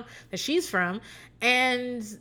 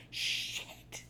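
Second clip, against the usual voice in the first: whispered shit; alarmed whisper; 17 dB quieter than usual. Pretend. Hearing her brother gush, a whispered shit. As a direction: breathe it out in alarm. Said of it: "I'd be like".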